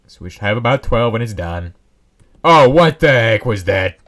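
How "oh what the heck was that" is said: loud startled slow exclamation. Real, not acted. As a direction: caught off guard by a noise. Sudden noise startles player.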